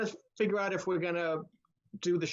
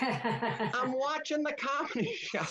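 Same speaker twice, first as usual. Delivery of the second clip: put-on high, slow, drawn-out old woman; elderly woman impression breaking into laughter; 7 semitones higher, slower than usual. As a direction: imitate the old lady, stretching it out through a laugh. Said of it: "you heard this old woman going".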